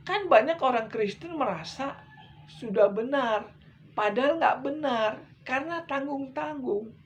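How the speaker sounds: pulpit-pounding sermon voice; preaching at full force. Acted. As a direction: drive the warning home like a fired-up preacher. Fiery preaching about antichrists.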